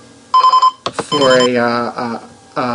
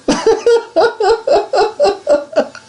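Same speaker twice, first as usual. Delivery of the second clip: squealing burst of laughter; laughing mid-apology; high giggle. Real, not acted.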